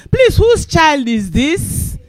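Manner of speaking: Saul asking. Retold or imitated. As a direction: ask slowly, truly puzzled.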